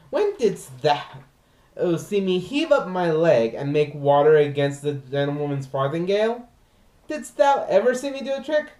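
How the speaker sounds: lively comic clown servant complaining of his dog; Launce monologue. Acted. Launce scolds his unfeeling dog, hammed-up comic lament. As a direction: play the put-upon servant, mock outrage.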